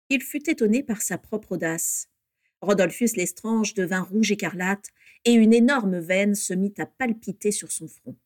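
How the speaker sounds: ordinary delivery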